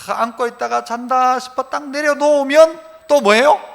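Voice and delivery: normal talking voice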